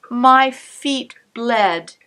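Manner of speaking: plain reading voice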